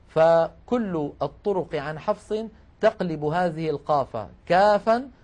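ordinary delivery

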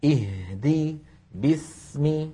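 slow "اهدي باسم" with equally held ha and seen; equal lengths. Shown as a demonstration. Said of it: "نفس الطول". («اهدي باسم» بتمهّل وزمن متساو)

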